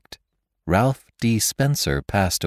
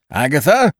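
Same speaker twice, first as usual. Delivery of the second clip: loud, high call into the vault; calling out loudly; 7 semitones higher, 5 dB louder than usual. A narrator's reading. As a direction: call the child's name as loud as you can. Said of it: "he called as loudly as he could".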